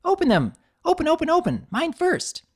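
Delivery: high, loud, pushy excitement; squealing demand; eager insistence. A narrator's reading. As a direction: hurry her to open them. Pinkie pushing Twilight.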